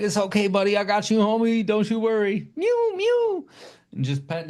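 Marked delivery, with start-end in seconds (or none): 0.0-2.5 soothing the cat. Retold or imitated. Comforting the cat in carrier. 2.6-3.4 high squeaky meow, meow; cat meows. Retold or imitated. To the cat in its carrier, high meowing.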